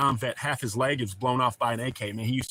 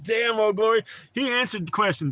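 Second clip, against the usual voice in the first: laughing; 10 semitones higher, 5 dB louder than usual. Real, not acted. Laughing at a viewer's answer.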